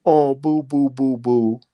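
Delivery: hammed-up fake whimper; mock boo-hoo. Waving off self-pity with mock boo-hoo. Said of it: "it's not 'cause I'm crying about".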